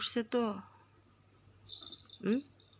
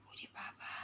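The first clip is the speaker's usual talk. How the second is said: whisper; 19 dB quieter than usual. Real, not acted. Reacting to angry cat.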